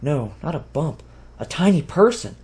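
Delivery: ordinary delivery, nothing marked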